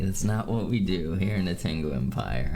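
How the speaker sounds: ordinary talk, nothing marked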